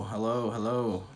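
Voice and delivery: ordinary talk, nothing marked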